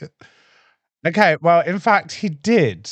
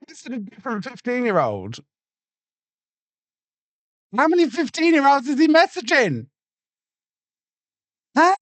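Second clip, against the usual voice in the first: surprised; 12 semitones higher than usual. Real, not acted. Shocked at news.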